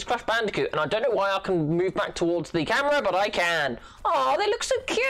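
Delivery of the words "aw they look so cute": cooing. Real, not acted. Gamer cooing at cute little enemies in a high baby voice. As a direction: gush over something adorable.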